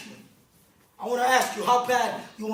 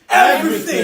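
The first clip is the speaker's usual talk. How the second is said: louder, with conviction; said like you believe it; 4 semitones higher, 10 dB louder than usual. Shown as a demonstration. Speaker showing the crowd how to say Everything, loud conviction. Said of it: "You gotta say it like you believe".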